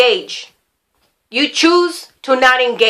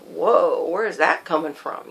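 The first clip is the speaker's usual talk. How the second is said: low, soft inner voice; thinking to oneself; 8 semitones lower, 7 dB quieter than usual. Retold or imitated. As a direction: murmur it privately in quiet surprise. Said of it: "thinking to myself".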